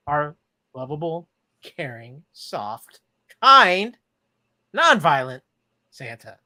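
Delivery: dry, mocking list of praise; sarcastic. Real, not acted. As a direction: say each word with heavy irony. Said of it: "note the sarcasm in my voice".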